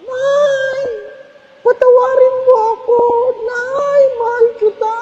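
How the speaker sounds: very high, fast wailing plea at the coffin; wailing mourner. Retold or imitated. Priest mocking a mourner who clings to the coffin, wailing high. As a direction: ham up a grieving child's cry. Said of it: "Yung niyayapos ang kabaong".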